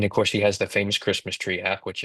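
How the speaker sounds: ordinary talk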